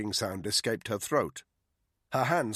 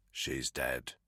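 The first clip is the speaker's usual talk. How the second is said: quiet, grim; 10 semitones lower, 8 dB quieter than usual. A narrator's reading. Confirming death quietly.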